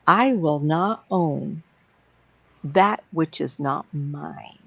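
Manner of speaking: very slow, drawn-out declaration; solemn affirmation. Shown as a demonstration. Teacher showing her saying to the group, solemn affirmation. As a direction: stretch each word out like a personal creed. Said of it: "I have an expression I say".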